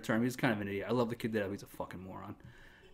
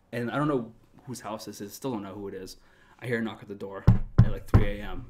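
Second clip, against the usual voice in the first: ordinary talk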